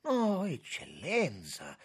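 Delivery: slow, high, horrified denial; horrified dismay. A narrator's reading. Lauriti denies it to the minister, aghast with horror. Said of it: "con sbalordimento d'orrore".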